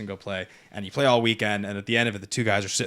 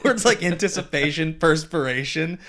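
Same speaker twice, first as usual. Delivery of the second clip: Australian accent; 7 semitones higher, 8 dB louder, much slower than usual. Shown as a demonstration. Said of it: "a thick Australian accent doing commentary".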